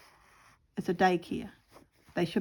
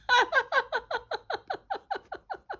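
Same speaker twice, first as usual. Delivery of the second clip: high squeal of laughter. Real, not acted. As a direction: giggle way up high. Laughing at own birdsong.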